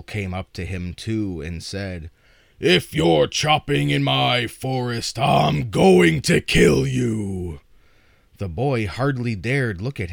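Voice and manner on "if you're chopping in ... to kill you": loud, slow menacing troll. Acted. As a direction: bellow the threat like a troll. Troll threatens second son.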